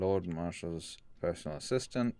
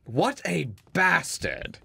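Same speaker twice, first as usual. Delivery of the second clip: high, loud, drawn-out mock outrage; hammed-up indignant cry; mock indignation; 9 semitones higher, 7 dB louder, slower than usual. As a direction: slowly exclaim in pretend outrage. Reacting to the lord's good deeds.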